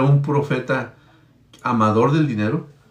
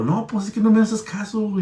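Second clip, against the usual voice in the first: higher, exasperated retort in a put-on voice; angry prophet; 8 semitones higher than usual. Prophet scolding his talking donkey, in a higher, exasperated voice. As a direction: snap back like a frustrated prophet. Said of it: "el hombre estaba tan enojado".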